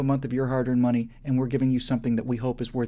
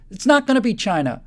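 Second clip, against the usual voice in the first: louder and higher, punching NOT; emphatic loud denial; 7 semitones higher, 6 dB louder than usual. Real, not acted. Rallying an audience against a rival, loud defiant denial. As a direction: slam the door on the idea, forcefully. Building rebuttal, rising emphasis.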